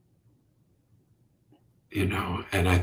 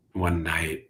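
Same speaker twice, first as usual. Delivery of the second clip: ordinary talk, nothing marked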